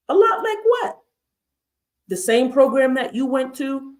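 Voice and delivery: very high, disbelieving question; high-pitched mock affront; incredulous comeback. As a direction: challenge the assumption, voice shooting up. Acts comeback to backhanded remark.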